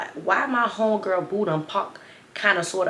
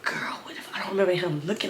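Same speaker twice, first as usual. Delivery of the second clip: unvoiced whisper to a friend; whispered aside; 6 dB quieter than usual. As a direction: hush it like gossip.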